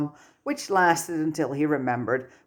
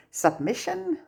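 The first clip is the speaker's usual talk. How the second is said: much higher, unsure guess; tentative guess; 13 semitones higher than usual. Acted. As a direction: offer it as a hopeful guess. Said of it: "Tom guessed".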